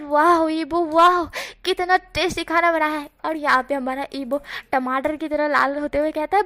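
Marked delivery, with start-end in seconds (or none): 0.0-3.1 higher and louder, raving through a mouthful; greedy delighted praise. A narrator's reading. Jan, wolfing down Ibo's cooking, raves higher and louder. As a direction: gush over the food like a starving man. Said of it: "भुक्खड़ों की तरह खाते हुए कहते हैं".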